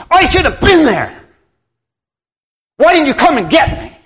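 the mother's hurt, angry complaint. Retold or imitated. Mother scolding her son for not fetching her, angry. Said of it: "she's angry, and she's mad".

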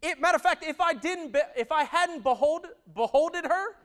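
loud, driving preacher voice; full-force preaching. Acted. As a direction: preach it out to the whole room.

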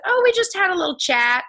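high, coy, innocent lilt; mock innocent. To the doctor, hiding what happened, mock innocent. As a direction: play it off sweetly, all innocence.

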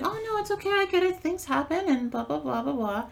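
high, sweet mock of an understanding client; imitating a nice client. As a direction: chirp it like an overly nice customer. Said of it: "that are really nice when something wrong happens".

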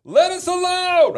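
high, loud demon's cry; drawn-out yell at a high pitch; crying out loudly. Acted. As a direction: cry out as the possessed man. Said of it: "cried out with a loud voice".

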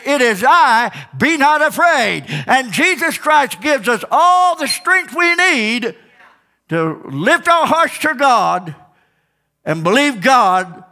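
high, building sermon cry; preaching. Acted. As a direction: preach it with mounting fire. Preaching at full force.

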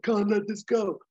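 mock action-hero resolve; Hawk's line. Pitching the sequel, quoting Hawk with mock resolve. Hawk quoted in retold plot.

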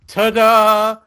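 high, loud, drawn-out ta-da; showy hero flourish. Retold or imitated. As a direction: announce a triumphant arrival. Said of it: "she's like".

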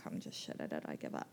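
low, soft mock-defeated aside; defeated mumble. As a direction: mutter it like you've lost all hope. Quoting her defeated past self.

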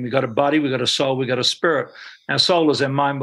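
ordinary delivery

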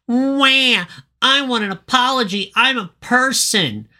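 very high, whining bird voice; whiny bird. Retold or imitated. Bird demands an apology from everyone, high whiny bird voice. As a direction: whimper it like a spoiled little bird. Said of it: "I wrote that the bird's a whiner".

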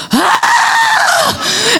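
very high hammed-up scream of rage; frustrated yell. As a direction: let the house hear you're mad. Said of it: "People needed to know that I was mad".

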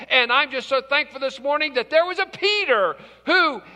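ordinary talk, nothing marked

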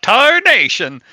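put-on high startled old-timer shout. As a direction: yelp it like a spooked old prospector. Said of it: "Gus will shout".

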